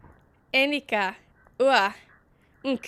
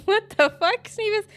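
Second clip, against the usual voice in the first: higher, louder, laughing what the fuck; laughing disbelief; 10 semitones higher, 8 dB louder than usual. Real, not acted. Player laughing at a weird game name, laughing disbelief. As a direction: burst out laughing at the screen.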